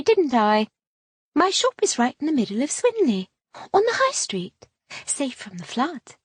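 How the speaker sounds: high, pushy society chatter; gushing lady. A narrator's reading. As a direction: insist sweetly but relentlessly. Harriet voiced by narrator.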